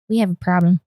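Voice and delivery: low, deadpan announcement; grave confession. Retold or imitated. Daughter coming out to the living room, low grave confession. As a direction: drop it low, like breaking bad news.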